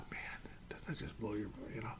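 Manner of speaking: whispered, very soft disbelief; stunned whisper. Real, not acted. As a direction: let it fade to a whisper. Still stunned, trailing off.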